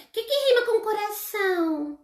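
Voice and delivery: put-on high, curious little child asking; child's question. Teacher voicing a child asking about rhymes, high and curious. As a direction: pipe it up like a small pupil. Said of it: "ela vai falar".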